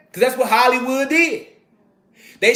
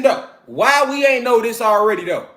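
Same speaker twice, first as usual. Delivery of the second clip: ordinary talk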